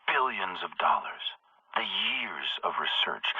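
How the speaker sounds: hushed, secretive whisper. Acted. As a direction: breathe it like a secret. Villain reveals his secret weapon.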